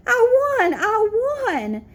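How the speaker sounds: high, loud shouts of joy; excited cheer. A narrator's reading. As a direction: squeal it out as if you just won. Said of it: "I said as I jumped".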